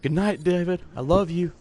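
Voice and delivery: lightly voiced drunk brother getting sentimental, then snapping; drunk goodnights. Drunk Jordan bidding each friend goodnight before passing out, mock-sentimental.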